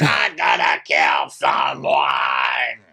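low, slow mock roar; deep drawn-out imitation of rage; furious outburst. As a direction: growl it out slowly like an enraged man. Retelling Craig's outburst.

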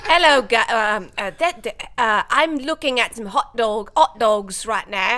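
halting, loud mock British; British accent. Guest tries British for the Englishman, hammy and hesitant. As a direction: fumble through a put-on British accent. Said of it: "when I do British accent".

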